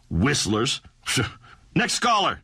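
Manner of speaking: ordinary talk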